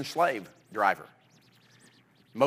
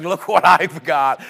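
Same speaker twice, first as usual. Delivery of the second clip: louder, slow boastful show-off; proud boy; 9 dB louder, slower than usual. Retold or imitated. David carrying Goliath's head around, boasting loudly. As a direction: gloat like a kid with a trophy.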